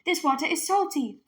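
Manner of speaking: surprised exclamation. Acted. Girl at the stream, dismayed exclamation. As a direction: complain about the taste. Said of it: "Minley exclaimed".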